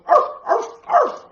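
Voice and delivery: high, loud yappy barks; dog barking. Retold or imitated. The little girl's doggy barking, high and loud. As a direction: bark like a small excited dog. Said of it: "her doggy is going".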